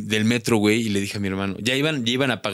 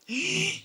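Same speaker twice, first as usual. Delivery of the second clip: shocked gasp; 7 dB quieter than usual. Retold or imitated. Hears fine is per person.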